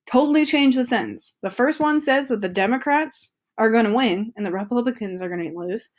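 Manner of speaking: usual speaking voice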